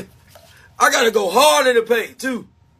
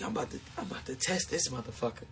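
hushed, fast, lower inner thought; scheming thought said under the breath; 10 semitones lower, 18 dB quieter, faster than usual. Retold or imitated. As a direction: mutter the plan to yourself quickly. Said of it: "I was like".

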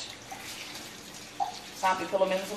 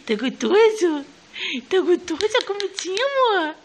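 loud, high sing-song coaxing; mother feeding her son in a loud, high sing-song voice; 5 semitones higher, 7 dB louder, slower than usual. Real, not acted. Feeding baby, airplane sounds.

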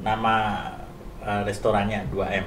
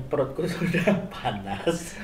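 laughing; 6 semitones higher, 6 dB quieter than usual. Real, not acted.

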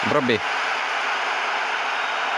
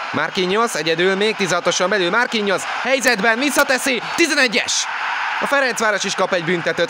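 higher and rising to the penalty call; excited sports commentary; 8 semitones higher than usual. Real, not acted. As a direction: lift it as the chance builds. Attack earns a penalty.

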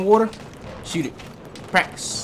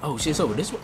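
ordinary delivery

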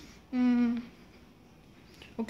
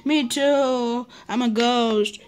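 ordinary delivery

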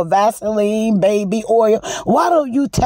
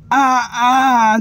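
drawn-out fake wail; hammed-up wail; mock wailing cry.

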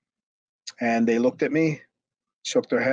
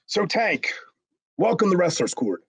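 higher-pitched mock court announcement; imitating JBL; 7 semitones higher than usual. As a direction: open proceedings in a put-on higher voice. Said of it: "that was, you know, J B L".

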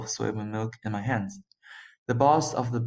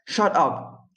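high, moderately raised yell; 6 semitones higher, 4 dB louder than usual. A narrator's reading. Boss silencing his driver, yelled. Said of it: "the boss yelled at the driver".

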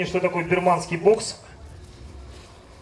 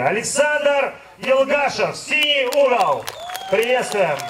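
slow, higher announcer call; boxing announcer; 4 semitones higher, slower than usual. Acted. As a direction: draw out the name for the crowd. Announcing fighter's corner.

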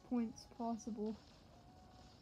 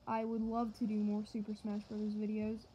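usual speaking voice

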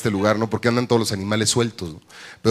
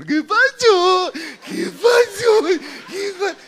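comic high shout; much slower than usual. Retold or imitated. New father yelling at the nursery, high slow shout. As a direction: stretch it out, yelling for attention.

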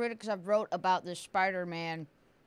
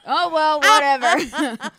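laughing; 5 semitones higher, 11 dB louder, slower than usual. Real, not acted. Laughing off wrong side.

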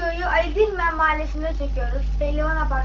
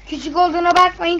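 usual speaking voice

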